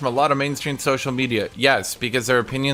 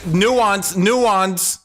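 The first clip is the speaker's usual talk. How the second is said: mock flustered plea; 6 semitones higher, 3 dB louder than usual.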